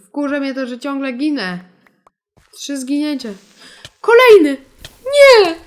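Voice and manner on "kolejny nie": high, loud cry of alarm; panicked shout. Real, not acted. Player spots another creeper, panicked yell. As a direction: yell as if the danger is right there.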